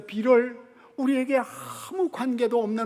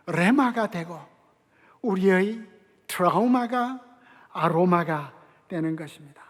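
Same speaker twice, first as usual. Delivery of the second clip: normal talking voice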